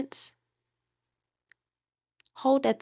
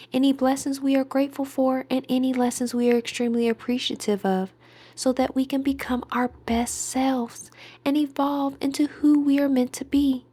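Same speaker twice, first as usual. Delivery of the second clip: normal talking voice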